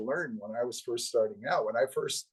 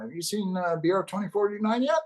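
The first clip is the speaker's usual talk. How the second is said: higher New Jersey voice asking; a friendly New Jersey question; New Jersey accent; 8 semitones higher than usual. Retold or imitated. Friend asks Paul about the film, New Jersey accent. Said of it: "he's got a New Jersey accent".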